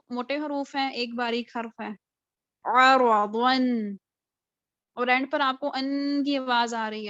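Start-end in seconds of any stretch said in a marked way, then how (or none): 2.8-4.0 آ را، ون slow, with a clear نون sound at the end; tanween demo. Shown as a demonstration. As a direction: land on the ن of ون. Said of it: "یہی تنوین کی sound ہوتی ہے". (تنوین کی آواز واضح)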